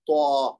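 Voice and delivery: توا said thick and heavy, tongue tip at the tooth roots. Shown as a demonstration. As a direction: make توا full and heavy so it differs from تا. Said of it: "تھوڑی سی موٹی کر کے آواز نکالیں گے توا". (توا کو موٹی آواز میں ادا کریں)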